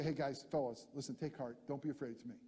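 soft, hushed comfort to frightened men; calm them quietly, it's only me; reassuring Jesus. Acted. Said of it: "Jesus is gonna say".

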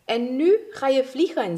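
high, loud eager command; urging on. Acted. Pushes friend to fly.

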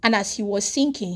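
normal talking voice

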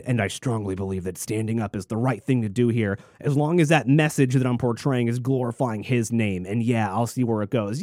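mock pious quote. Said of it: "He also said".

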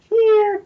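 high-pitched mouth-made violin screech; mock suspense-show violin noise; imitated violin sting. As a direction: squeal a dramatic string sting. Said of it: "like, a violin sting".

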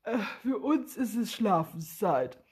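said through a yawn; sleepy and yawning. A narrator's reading. Willi telling Wladimir it is bedtime, yawning. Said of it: "Willi gähnt".

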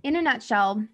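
ordinary delivery

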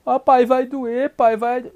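mock-frightened childish whining; whiny child pleading. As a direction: beg dad like a scared kid. Acts child pleading with father.

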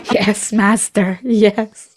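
slow, fawning servant voice; hunchback assistant. Pretend. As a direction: grovel to your master.

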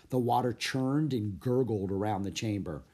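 ordinary delivery, nothing marked

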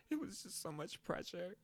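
high, soft mock whimper; mock pleading excuse. Telling his mom his excuse, in a high, pitiful mock whimper. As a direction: play the pitiful kid. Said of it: "You know what I told her?".

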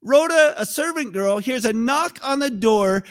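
ordinary talk

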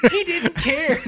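high, loud laughing exclamation. Real, not acted. Hunter telling friend the buck ignored them, laughing. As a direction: crack up in disbelief.

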